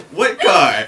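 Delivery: mock protest. Kid answering an accusing cop, loud mock innocence. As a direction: play innocent at high volume. Teller feigns ignorance to cop.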